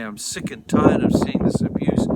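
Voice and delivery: loud, forceful burst of anger; acted fed-up outburst; angry, fed up. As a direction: burst out as someone who has had enough. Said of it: "and that is anger".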